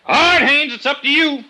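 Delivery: loud, high, hard final warning; shouted ultimatum. Acted. Detective yelling at the armed suspect below. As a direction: yell the last chance across the yard.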